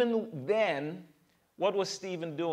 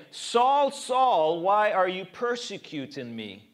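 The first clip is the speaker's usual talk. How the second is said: high ringing call of Saul; 3 semitones higher, 6 dB louder than usual. Acted. Voice of Jesus from heaven, calling out loud.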